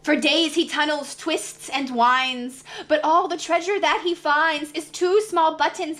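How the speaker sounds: ordinary delivery, nothing marked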